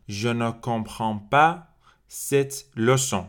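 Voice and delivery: very slow, clearly articulated French; slow model sentence. Shown as a demonstration. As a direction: spell it out for learners.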